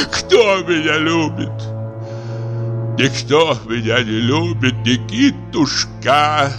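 put-on drawn-out drunken self-pity; anguished lament. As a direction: plead like an unloved old man. Lonely actor laments nobody loves him.

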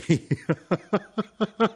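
high, light staccato laugh. A narrator's reading. Son laughs at his mother's retort, high laugh. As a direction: laugh in a long string of high notes. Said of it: "Io risi".

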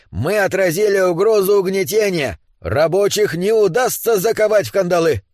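high-pitched rally oratory; triumphant sloganeering. Acted. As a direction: proclaim victory to the crowd. Dwarf yells victory slogans. (торжествующе, как на митинге)

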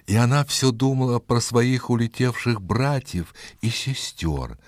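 ordinary delivery

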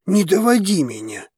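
angry, restrained roar; growled. A narrator's reading. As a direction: growl it as patience runs out. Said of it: "рыкнул мужчина".

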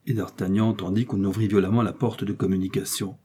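usual speaking voice

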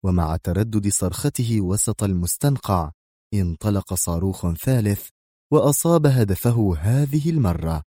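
ordinary delivery, nothing marked

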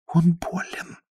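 soft, anxious whisper; voiceless, quiet confidence. A narrator's reading. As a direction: whisper it as a hushed plea. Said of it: "прошептала Зоя".

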